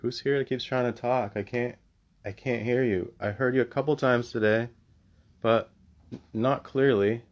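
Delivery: calling out loudly to the spirit; talking to a ghost. Real, not acted. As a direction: raise your voice to an empty room. Calls out to spirit.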